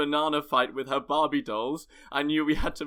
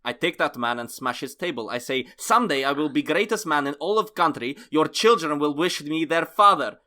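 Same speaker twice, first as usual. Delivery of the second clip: put-on defiant vow in a comic Russian accent; 3 semitones higher than usual. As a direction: proclaim it like a proud Russian. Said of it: "I prefer doing the Russian accent".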